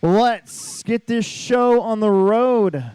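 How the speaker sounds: slow, loud announcer call; announcer hype. Real, not acted. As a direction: stretch it out like a ring announcer. Announcer kicking off main event.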